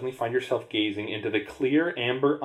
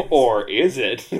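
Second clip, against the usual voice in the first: normal talking voice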